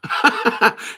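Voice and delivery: quick loud chuckle; real laugh. Real, not acted. Host admitting a podcaster annoyed them, laughing. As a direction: burst into a short laugh.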